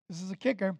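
normal talking voice

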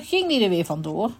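plain reading voice

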